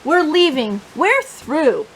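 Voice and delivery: lively, expressive picture-book reading; reading to children. Acted. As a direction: tell the story to a room of little kids.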